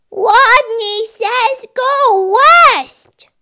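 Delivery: high, loud, slow rodent shout; emboldened little rodent bellowing; strong-voiced call. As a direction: call out the command boldly, w for r. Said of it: "In a voice so strong".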